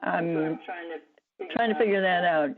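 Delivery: speaking through a phone line; over the phone. Real, not acted. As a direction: voice coming down a phone. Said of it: "I'm speaking through my phone".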